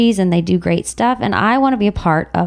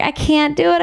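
higher, strained, on the edge of crying; tearful; 7 semitones higher than usual. Real, not acted. Mother overwhelmed by saying no, tearful. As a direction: let the voice tighten with tears. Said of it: "And that's why I cried".

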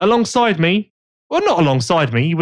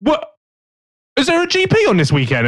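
higher, baffled mock host voice; clueless host; 11 semitones higher than usual. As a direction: play him lost and confused. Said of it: "you're just gonna go".

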